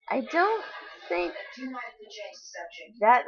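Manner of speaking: usual speaking voice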